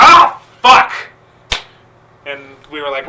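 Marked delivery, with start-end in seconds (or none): 0.0-0.9 loud startled yelp; shocked shout. Retold or imitated. The wrestlers reacting as the chair breaks, shouted. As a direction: blurt it out in shock. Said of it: "And we went".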